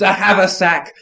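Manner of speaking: high, loud royal proclamation; a king crying out for his haversack; monarch's cry. Acted. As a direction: declaim like a king. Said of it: "I cried in monarch's tones".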